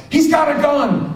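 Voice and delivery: high, loud mock warning shout; called-out alarm. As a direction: yell a sudden warning across the room. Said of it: "calls out".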